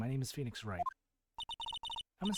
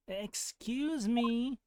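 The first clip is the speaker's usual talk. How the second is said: drawn-out, raised "Excuse me" calling for attention; louder call; 10 semitones higher, 5 dB louder, much slower than usual. Acted. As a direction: call out slowly and loudly to get him to turn. Calling louder to ignored stranger.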